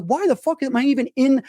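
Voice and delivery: high, indignant mock yell; a high, exasperated mock shout; retold yell. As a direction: demand it in half-joking outrage. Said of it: "I kinda yelled at her a bit".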